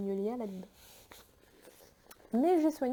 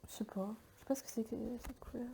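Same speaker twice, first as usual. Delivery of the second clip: quiet aside; 11 dB quieter, faster than usual. Real, not acted. Muttered aside about colour.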